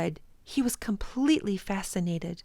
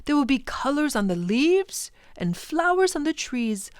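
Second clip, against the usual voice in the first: louder, eager teacher listing colours; enthusiastic professor; 5 semitones higher, 7 dB louder than usual. Acted. Professor telling the children what they'll see, louder and eager. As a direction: sweep them up in your excitement.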